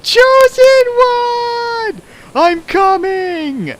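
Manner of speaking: very high, very slow hammed-up swooning wail; mock fangirl cry. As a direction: swoon and cry out for the chosen one. Mock fangirl scream at Sato.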